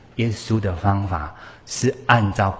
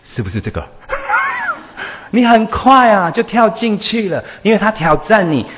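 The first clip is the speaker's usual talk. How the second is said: ordinary talk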